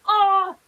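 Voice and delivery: high, loud "Oh" of letdown; disappointed groan. Shown as a demonstration. Showing how she reacts to disappointment, a loud high groan. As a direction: groan like you just missed out. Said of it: "I go".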